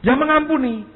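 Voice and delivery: high, loud exasperated answer; exasperated pastor pushing forgiveness; insistent reply. Retold or imitated. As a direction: say it high and loud, pressing the point. Preacher answers girl insistently.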